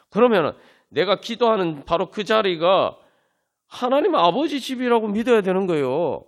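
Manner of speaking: high, loud, ringing pulpit declaration; impassioned preaching. Acted. Preacher driving home the point to his congregation, impassioned preaching. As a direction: proclaim it to the back pew with rising fervor. Builds to full-force preaching.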